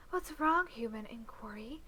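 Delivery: ordinary delivery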